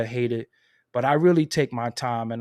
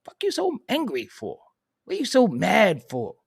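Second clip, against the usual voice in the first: much higher put-on voice brushing off haters; dismissive retort, voiced high; mock retort. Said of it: "And I be like".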